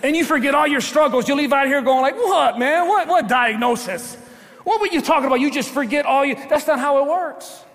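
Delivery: high, loud preacher's exclamation; animated preaching. Acted. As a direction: preach it with incredulous energy.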